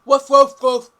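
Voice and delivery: loud barking woofs; dog woof. Pretend. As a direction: bark it out like a big dog. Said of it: "You are a dog".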